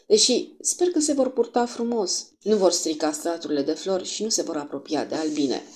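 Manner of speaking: ordinary delivery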